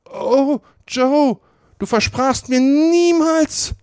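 viel höher, lauter, langsam; verzweifelter Ausruf. A narrator's reading. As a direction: ruf Joe verzweifelt an. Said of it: "rief aus". (Potter ruft verzweifelt, hoch und langsam)

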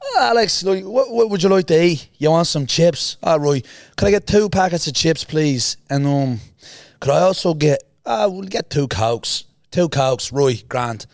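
high, lilting mock Irish; put-on Irish accent. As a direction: order snacks as a pretend Irishman. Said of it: "So I'm pretending to be Irish".

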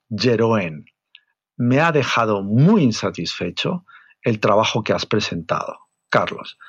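stern boss reprimand, MUY leaned on hard; deliberate, severe dressing-down. Shown as a demonstration. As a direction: read it slowly and sternly, like a harsh email. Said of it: "voy a leerlo en tono duro".

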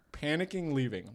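ordinary talk, nothing marked

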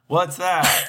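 high squeaky Jayla impression; Jayla's puzzled question, way up high. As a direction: pitch way up for Jayla. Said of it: "That's a good Jayla voice".

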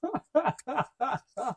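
long giggle after own joke; helpless run of laughter; laughing. Real, not acted. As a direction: crack up at your own impression. Laughs at own crying impression.

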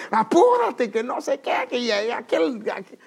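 high, loud rush-up yell at his wife; impatient hurrying shout. Retold or imitated. Husband rushing his late wife out the door, high impatient shout. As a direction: bark at her to hurry, voice climbing.

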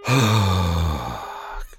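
long weary sigh. Real, not acted. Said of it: "weary sigh".

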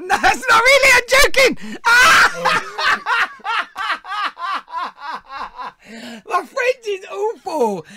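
high, loud shrieking laughter through the words; laughing hysterically. Real, not acted. After his fake French prank call, shrieking with laughter. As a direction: crack up so hard the words squeal out.

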